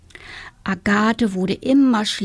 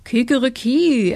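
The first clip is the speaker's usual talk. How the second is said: crowing like a rooster; 4 semitones higher, 4 dB louder than usual. A narrator's reading. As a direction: sing it out like a cock's crow. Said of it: "Da stolzierte der Hahn heran und rief".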